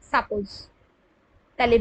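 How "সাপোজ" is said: ordinary delivery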